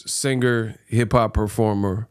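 ordinary talk

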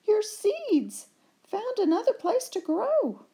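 very high, loud, thrilled; excited high voices. Acted. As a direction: burst out the good news. Friends announce excitedly.

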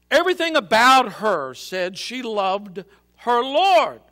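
high, loud anguished outburst. A narrator's reading. Betrayed Joseph crying to the empty room, anguished outburst. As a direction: pour out betrayed grief. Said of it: "the young man anguished".

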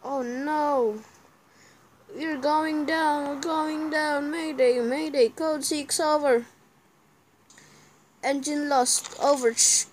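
high, urgent pretend pilot distress call; mayday radio call. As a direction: play a crashing pilot radioing for help. Pretend plane crash radio call.

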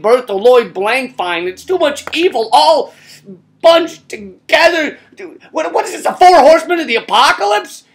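high, loud exasperated rant; mock outrage. Comedian ranting at listeners in high-pitched mock horror. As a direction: throw up your hands in horror. Horrified outburst at Olson.

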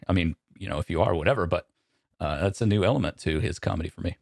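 usual speaking voice

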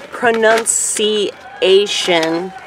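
"pronunciation" drawn out very slowly, syllable by syllable; "pronunciation" as pro-nun-see-ay-shun, stretched out; over-careful pronunciation. Shown as a demonstration. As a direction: sound out "pronunciation" in slow, separate pieces. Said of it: "you fucked up that word".

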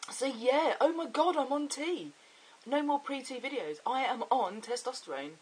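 real excitement. Real, not acted. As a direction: proclaim it with rising excitement. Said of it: "'cause I'm just so excited".

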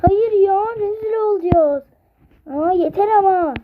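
put-on high, loud kid whine; whiny protest. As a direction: complain like an embarrassed kid. Çocuklar utançla itiraz ediyor. (utanan çocuğun yüksek sesle sızlanması)

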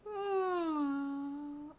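soft, whiny cat hum; cat calming down beside the guest; soft cat purr. Pretend. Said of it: "she's making nicer sounds now".

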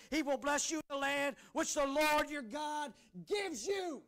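loud, high-pitched proclamation of scripture; full-force preaching. Real, not acted. Pastor declaring God's promise to his church, full-force preaching. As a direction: drive each promise out over the congregation.

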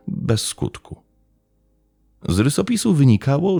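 plain reading voice

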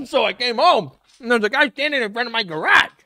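laughing mock retelling of his story; parroting his words back, amused; mocking parody retelling.

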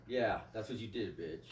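ordinary talk

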